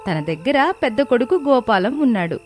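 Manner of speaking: ordinary delivery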